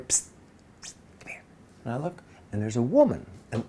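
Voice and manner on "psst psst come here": hushed, voiceless summons; furtive whisper. Retold or imitated. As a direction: whisper to someone sneaking in. Hissed at during a rehearsal.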